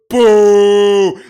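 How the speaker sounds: loud hammed-up ghost boo; cartoon ghost boo. Friend spooking the chat, loud cartoon ghost boo. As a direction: boom it like a Scooby-Doo ghost. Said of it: "like a stupid ghost from Scooby-Doo".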